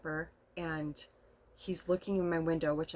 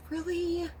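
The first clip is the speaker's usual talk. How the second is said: much higher, tearful disbelief; surprised really; 12 semitones higher than usual. Retold or imitated. Asking the neighbor if he really means it, in high amazed disbelief. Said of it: "I said".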